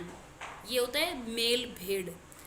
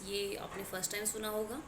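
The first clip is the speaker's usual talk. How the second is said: ordinary talk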